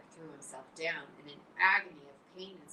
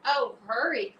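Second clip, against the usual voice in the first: higher, louder urgent cry; cried out; 7 semitones higher, 11 dB louder than usual. Acted. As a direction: hurry him up. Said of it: "cried Wilbur".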